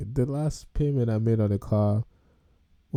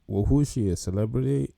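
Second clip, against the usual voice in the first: ordinary talk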